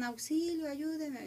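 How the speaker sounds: retold cry for help. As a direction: call out for help like the drowning girl.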